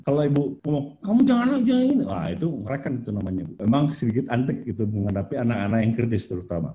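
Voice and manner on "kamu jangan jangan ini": quick, high mock scolding; hurried parental telling-off, pitched up. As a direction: imitate a fussing parent, fast and high. Acting a parent forbidding the child.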